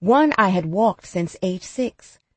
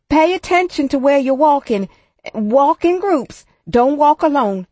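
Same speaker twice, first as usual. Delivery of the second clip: quivering voice; 7 semitones higher, 6 dB louder than usual. A narrator's reading. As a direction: call out the warning, voice quivering. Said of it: "my teacher's quivering voice".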